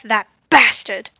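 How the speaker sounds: put-on a clumsy British accent under the breath; bad British accent. Player under breath, botched British accent. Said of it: "that was a bad accent".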